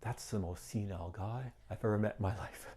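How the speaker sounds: hushed amused scoffing; quiet laughing aside. Retold or imitated. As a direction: mutter it to yourself, amused. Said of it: "And I go".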